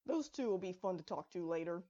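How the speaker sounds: usual speaking voice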